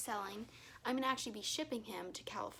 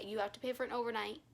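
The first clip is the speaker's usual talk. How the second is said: ordinary talk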